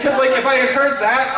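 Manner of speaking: ordinary delivery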